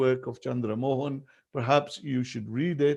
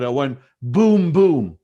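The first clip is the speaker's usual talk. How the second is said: mock explosion; 8 dB louder than usual. Son mimics explosion to the boy.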